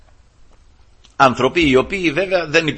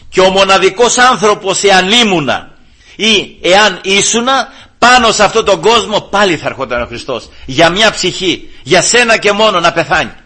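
louder, higher, with rising fervour; fervent preaching; 6 semitones higher, 6 dB louder than usual. Acted. Preacher to congregation, loud fervent preaching. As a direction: drive the point home to the congregation.

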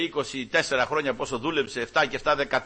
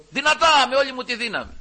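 Δυνατά burst out high and loud; 9 semitones higher, 6 dB louder than usual. Real, not acted. Preacher showing how he cries to God, loud. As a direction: shout Δυνατά with all your strength. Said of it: "αρχίζω και φωνάζω στον Κύριο".

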